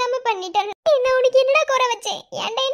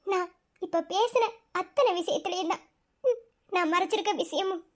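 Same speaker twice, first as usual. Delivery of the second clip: much softer, lower, near a whisper; hushed confession; 4 semitones lower, 7 dB quieter than usual. Acted. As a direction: let the secret slip quietly. (மெதுவான ரகசியக் குரல்)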